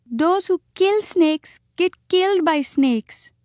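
sorrowful, high and slow; mournful little muskrat lament, drawn out; Chuchundar. A narrator's reading. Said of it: "more sorrowfully than ever".

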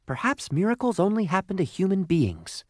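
high little voice, losing hope; small, high-voiced disappointed coal; despondent lump of coal. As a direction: muse forlornly, pitched well up.

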